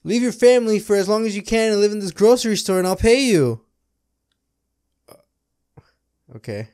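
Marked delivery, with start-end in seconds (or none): 0.0-3.6 mock host pitch. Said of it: "Mister Beast".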